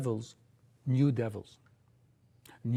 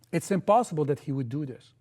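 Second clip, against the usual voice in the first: ordinary delivery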